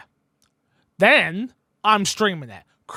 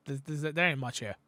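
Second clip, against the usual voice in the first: ordinary delivery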